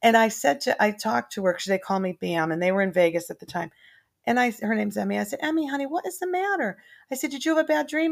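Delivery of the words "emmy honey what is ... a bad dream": put-on high, gentle questions to a small child; tender grandma voice. Grandma gently asking her scared granddaughter about bad dreams.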